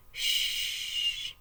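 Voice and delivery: a man hushing his crying wife. Acted. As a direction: hush her gently. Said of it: "he whispers".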